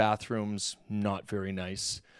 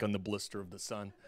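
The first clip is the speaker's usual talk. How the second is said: usual speaking voice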